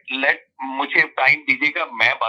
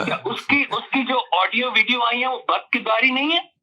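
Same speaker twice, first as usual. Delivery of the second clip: heated argument; 8 semitones higher than usual. Real, not acted. Heated caller argues with host.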